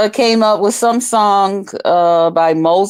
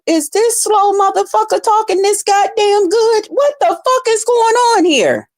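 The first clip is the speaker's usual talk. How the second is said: high, amazed squeal of disbelief; shocked disbelief; 12 semitones higher than usual. Retold or imitated. Imitating a stunned viewer, high and amazed. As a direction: gasp it out like you can't believe he talks this well. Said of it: "you'll be like".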